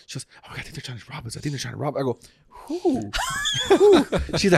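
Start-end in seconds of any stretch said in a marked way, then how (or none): 0.4-2.0 paranoid hush. Retold or imitated. Retelling wife's paranoid panic. 2.5-4.0 incredulous. Retold or imitated. Husband answering wife's fear of being robbed, incredulous.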